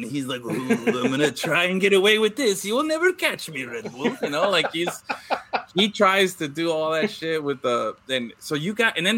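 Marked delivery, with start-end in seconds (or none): 0.4-4.1 higher, laughing mock cartoon villain; sleazy villain. Mocking the Renault boss as a gloating sleazy villain. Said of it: "he is the villain".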